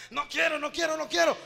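put-on loud, high, childish "no quiero"; stubborn shouted refusal. Rebellious sheep refusing loudly. (negativa gritada y terca)

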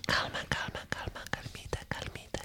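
hushed, very soft repeated whisper; soothing whisper. Pretend. A speaker urging calm, soothing whisper. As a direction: whisper it like a calming little chant.

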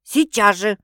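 barked order. A narrator's reading. Sister demanding her location, barked. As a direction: bark it at her. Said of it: "рявкнула Света".

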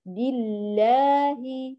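slow بالله with a thin, light l; light lam. Shown as a demonstration. Teacher models the divine name, lam thinned and slow. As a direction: recite بالله slowly, lam kept light. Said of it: "مباشرة بنرقق اللام".

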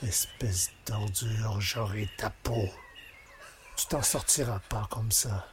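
quiet murderous menace; low menacing threat. Acted. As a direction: hiss the hatred under the breath. Threatening the mayor.

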